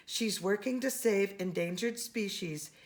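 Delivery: ordinary delivery, nothing marked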